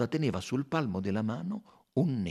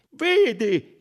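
loud, high call; crying out; 14 semitones higher, 12 dB louder than usual. A narrator's reading. Doctor to Poirot, crying out over the watch. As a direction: call his attention urgently. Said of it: "gridò Costantin".

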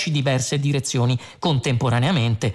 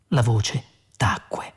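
ordinary delivery, nothing marked

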